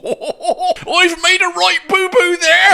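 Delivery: put-on loud, high, drawn-out Gloucestershire accent. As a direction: ham up a slow Gloucestershire yokel accent, loudly. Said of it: "a Gloucestershire accent, which is where I come from".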